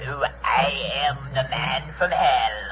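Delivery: low, soft demonic voice; man from hell. Acted. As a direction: menace them from way down low. Demonic visitor introduces himself.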